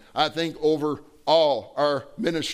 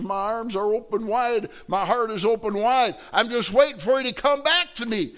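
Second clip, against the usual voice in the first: rising higher and louder; swelling plea; 7 semitones higher, 3 dB louder than usual. Acted. God calling his children home, swelling louder. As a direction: build the invitation to a peak.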